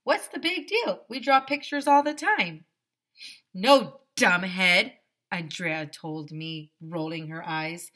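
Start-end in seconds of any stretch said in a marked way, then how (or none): 0.0-2.7 put-on high puzzled kid. Kid confused, raised voice. 3.5-5.0 put-on snooty girl calling him dumbhead, slow and loud; eye-rolling contempt; slow scornful put-down. Andrea scolds narrator.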